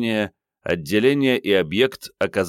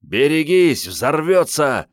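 loud, high, drawn-out yell; yelled warning; 8 semitones higher, 5 dB louder, slower than usual. A narrator's reading. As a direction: bellow an alarm to everyone nearby. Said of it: "для страховки заорал Андрей".

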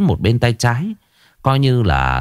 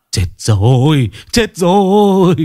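much higher, louder, slower wail; despairing cry; 9 semitones higher, 4 dB louder, slower than usual. Acted. Father learning his son was hit by a car cries out, much higher and louder. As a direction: cry out in dread, dragging the words.

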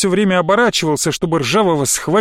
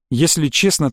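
plain reading voice

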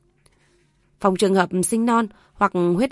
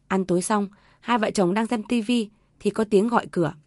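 usual speaking voice

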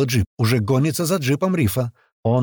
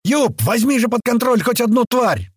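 high, exasperated yell; shouting into radio; 11 semitones higher, 4 dB louder than usual. A narrator's reading. Fighter begging Юп to control a beast, shouting. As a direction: holler a desperate demand over the radio. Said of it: "снова кричит кто-то в рацию".

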